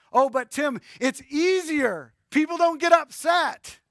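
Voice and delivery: loud, high mock protest; protesting objection. Listener objecting to the preacher that compromise is easier, protesting objection.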